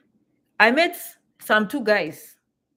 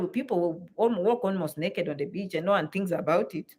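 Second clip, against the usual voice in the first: ordinary delivery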